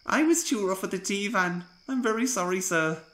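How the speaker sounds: sad apology. Acted. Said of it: "ventured Ivo Hugh sadly".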